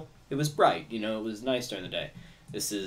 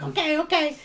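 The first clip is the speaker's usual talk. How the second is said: very high, louder squeaky okay; backing-down goblins; 6 dB louder than usual. Acted. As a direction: goblins grudgingly giving in. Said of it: "they're like".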